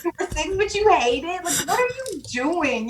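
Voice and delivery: high-pitched, disbelieving challenge; mock incredulous. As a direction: call her out in exaggerated disbelief. Mock confronting the woman she retells.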